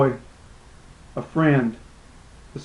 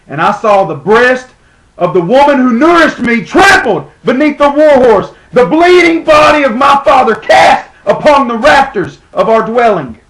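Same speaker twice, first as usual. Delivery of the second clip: high, loud theatrical cry of outrage; anguished outcry; 8 semitones higher, 6 dB louder than usual. Acted. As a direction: build to a shout of horror at the slaughter. Recalls parents killed by Romans.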